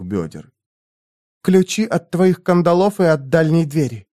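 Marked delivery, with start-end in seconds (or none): none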